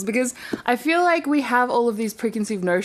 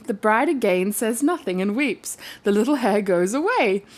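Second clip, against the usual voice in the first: ordinary delivery, nothing marked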